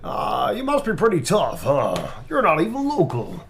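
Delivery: loud, high swooping taunt; theatrical character voice. Acted. Performing the requested line, arch and loud.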